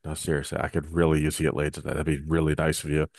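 low, soft come-hither voice; low seductive voice. Pretend. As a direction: ask low and hushed, pleading. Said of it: "a low voice like this".

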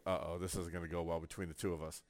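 soft, quick mock dread; quiet aside. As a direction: say it under your breath to yourself. Private aside about girl neighbour.

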